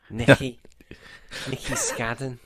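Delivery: a half-hearted Scouse take on the name; Scouse accent, tossed off with a laugh. Retold or imitated. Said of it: "didn't you pretend he was a Scouser?".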